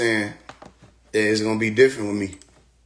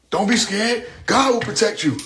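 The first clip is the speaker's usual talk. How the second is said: mocking voice; 9 semitones higher than usual. Mocking believers.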